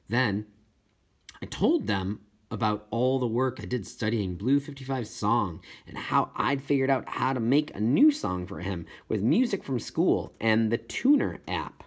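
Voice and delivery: usual speaking voice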